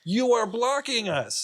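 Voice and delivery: high, drawn-out holler; mock yell across a parking lot; retold yelling. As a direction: call out each word slowly. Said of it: "I just start yelling".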